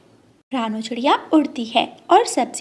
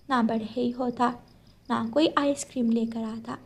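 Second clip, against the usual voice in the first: soft, tender motherly voice; calm her child down softly; gentle mother; 7 dB quieter than usual. Acted. Mother gently explaining to her daughter, soft and tender.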